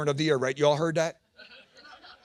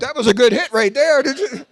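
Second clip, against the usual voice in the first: high, loud excited onlooker; hammed-up amazed neighbor; commenting neighbors; 7 semitones higher, 8 dB louder than usual. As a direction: marvel loudly like an impressed spectator. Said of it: "Neighbors would comment".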